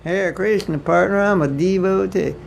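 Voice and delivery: mock American Southern twang, slow and high; Southern accent. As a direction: ham up a Southern American devotee. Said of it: "the Southern accent in America".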